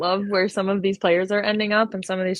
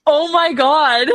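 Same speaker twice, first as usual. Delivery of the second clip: stunned; 6 semitones higher, 6 dB louder, slower than usual. Real, not acted. Reacting to shocking news.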